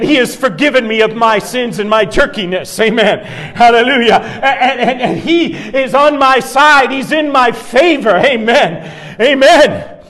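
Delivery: high, loud, laughing hallelujahs; preaching at full force. Acted. Pastor at the sermon's peak, loud joyful preaching. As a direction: shout the praise to the rafters. Shouting praise to climax.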